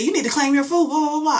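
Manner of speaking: high-pitched imitated announcement; announcer. Staff telling bowlers to claim food, mock announcer. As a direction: repeat it like a sing-out PA notice. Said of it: "they said".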